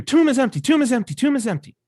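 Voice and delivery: loud, high, breathless rush; Mary running to Peter, shouting; excited Mary. Retold or imitated. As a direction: blurt it out in alarm. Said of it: "She says to Peter".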